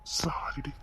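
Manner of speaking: a soft, breathy whispered sorry; whispering sorry to his upset wife; whispered apology. Acted. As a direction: plead it barely above a breath. Husband pleading softly with crying wife.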